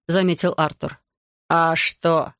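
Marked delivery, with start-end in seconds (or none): none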